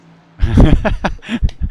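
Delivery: a burst of loud laughter. Real, not acted.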